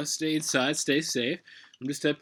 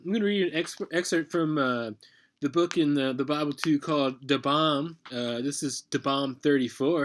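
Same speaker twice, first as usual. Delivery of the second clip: normal talking voice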